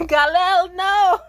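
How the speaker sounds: loud high yell through laughs; laughing yell. Real, not acted. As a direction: shout it out, cracking up. Calling out laughing.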